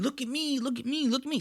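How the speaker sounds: put-on high, soft voice of a kid begging for attention; showing-off kid. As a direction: whine it like a child on stage. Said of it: "basically like a kid getting on stage".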